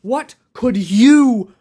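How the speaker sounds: mock-outraged demand; indignant shout. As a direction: yell it at the intruding toy. Indignant at the toy.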